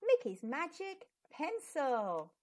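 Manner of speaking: ordinary delivery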